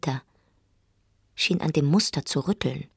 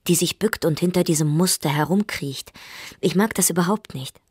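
plain reading voice

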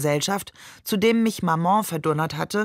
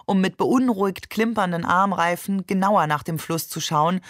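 plain reading voice

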